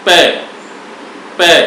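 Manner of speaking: पे said alone, with the ए sound released outward; isolated syllable. Shown as a demonstration. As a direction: sound out पे by itself, letting the vowel ring. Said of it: "आप पे को स्वतंत्र रूप से उच्चारित कीजिए". (पे को अलग से, ए की ध्वनि बाहर निकालते हुए)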